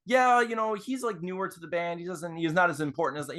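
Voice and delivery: high-pitched mock brush-off; ego-driven dismissiveness. Guitarist plays down the new bass player, with ego. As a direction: lightly play a guy talking down a bandmate. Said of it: "have an ego about him".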